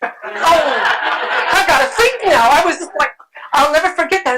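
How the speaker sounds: breathless joy of remembering the save; excited recollection. Retold or imitated. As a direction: relive the thrill.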